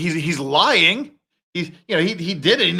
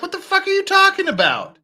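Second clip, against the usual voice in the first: mock outraged reply; faster than usual. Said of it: "I'd be like".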